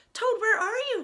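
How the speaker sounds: high call into the dark house. Acted. Frog calling into the dark room, high call. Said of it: "called Frog".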